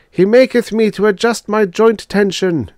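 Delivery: high, loud chant on one pitch; cried psalm verse. A narrator's reading. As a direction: proclaim it like robot scripture. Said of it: "cried the first group".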